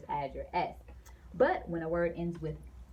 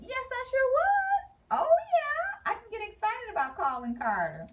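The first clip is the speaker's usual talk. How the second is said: excited; 5 dB louder than usual. Shown as a demonstration. Teacher acting out an excited answer for class, high and gushing. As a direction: squeal the yes like a thrilled kid.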